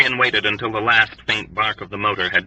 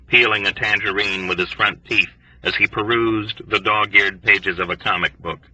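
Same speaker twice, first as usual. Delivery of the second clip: ordinary delivery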